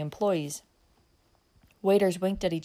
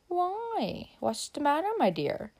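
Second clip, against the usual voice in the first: put-on stammering drunk; 8 semitones higher, slower than usual. A narrator's reading. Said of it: "stammered the other".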